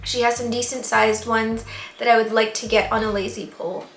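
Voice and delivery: usual speaking voice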